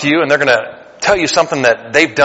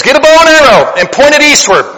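prophet's command; 12 semitones higher, 6 dB louder than usual. Retold or imitated. Preacher voices prophet's command.

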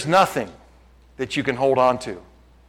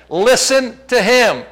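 slow, raised proclamation; commanding voice of God; 7 semitones higher, 4 dB louder, much slower than usual. Acted. God speaks from the mount about his Son, solemn command. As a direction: declare it slowly, higher, as a divine command. Quotes God's voice at transfiguration.

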